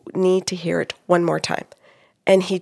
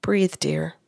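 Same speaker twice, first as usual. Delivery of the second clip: soft, low whisper; certain soothing tone; 4 semitones lower, 6 dB quieter than usual. Retold or imitated. Medium passing on a wife's message, hushed and soothing. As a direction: whisper it gently to calm him. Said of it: "in a certain tone of voice".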